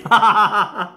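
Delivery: amused burst of laughter; hearty laugh. Real, not acted. Laughing at his own story.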